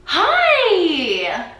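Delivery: high, loud imitation of a chummy stranger; overly familiar greeting. Stranger greets her in the grocery aisle, overly familiar. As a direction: greet like you already know them. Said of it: "He's kinda saying hi like he knows me".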